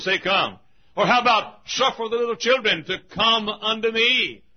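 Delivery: high, insistent pulpit delivery; urgent revival preacher; rousing preaching. Acted. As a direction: preach it rising on each challenge. Preaches invitations to the congregation.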